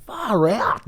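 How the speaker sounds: dismayed. Retold or imitated. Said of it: "staring myself in the mirror thinking".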